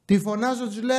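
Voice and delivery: ordinary delivery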